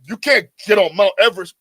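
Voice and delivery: loud, sneering mock voice; a mocking parrot of the excuse; mocking quote. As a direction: throw their excuse back at them with scorn. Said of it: "So you saying".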